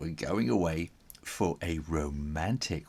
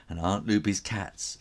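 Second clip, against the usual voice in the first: plain reading voice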